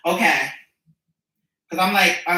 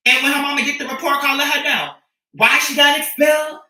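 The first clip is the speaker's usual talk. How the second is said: mock shout; 5 semitones higher than usual. Mock scolding the parent.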